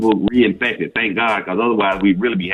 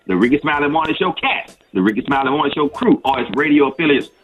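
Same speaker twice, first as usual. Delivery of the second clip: higher, brisk legal-disclaimer voice; disclaimer read; 4 semitones higher than usual. Acted. Doctor reading the show's disclaimer, in a brisk announcer voice. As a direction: rattle off the fine print like an ad.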